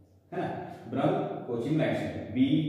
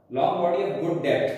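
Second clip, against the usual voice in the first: ordinary talk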